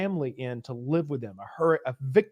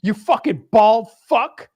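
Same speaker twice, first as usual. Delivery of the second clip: slow, loud, higher mock bravado; puffed-up taunt; 10 semitones higher, 8 dB louder than usual. Larry confronting the bald man, hammed-up loud bravado. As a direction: swagger into the insult. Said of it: "He's like".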